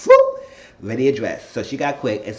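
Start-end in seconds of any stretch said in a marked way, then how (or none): 0.1-0.4 sharp high loud whoop. Retold or imitated. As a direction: pop it out like a sudden jump. Mimics quick getting dressed.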